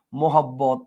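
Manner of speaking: low মোহাব্বত with a held double b; মোহাব্বত, said mo-hab-bot with both b's distinct; clearly sounded b. Shown as a demonstration. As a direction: press on the bb in মোহাব্বত. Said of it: "এটার উচ্চারণটা হচ্ছে একদম সুস্পষ্ট".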